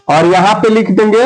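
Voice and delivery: ordinary talk, nothing marked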